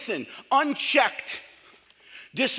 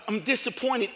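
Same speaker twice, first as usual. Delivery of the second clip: ordinary delivery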